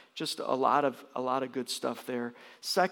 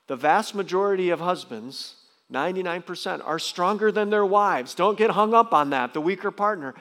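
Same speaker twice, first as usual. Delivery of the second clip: louder, pressing the point home; emphatic preaching; 4 semitones higher, 8 dB louder than usual. Acted. Pastor pressing husbands not to fixate, emphatic preaching.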